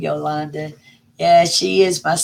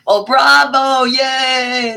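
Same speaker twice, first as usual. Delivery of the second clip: higher, louder bravo cheer; excited cheer; 8 semitones higher, 5 dB louder, much slower than usual. Real, not acted. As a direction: cheer the viewer on.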